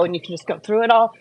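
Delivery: usual speaking voice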